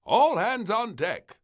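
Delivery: loud, high, slow call; crying out. Acted. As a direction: bellow it across the yard. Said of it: "cried a voice outside".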